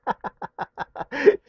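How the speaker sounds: a short high giggle; stifled laugh. Real, not acted. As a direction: snicker after the call drops. Prank caller laughs after hanging up.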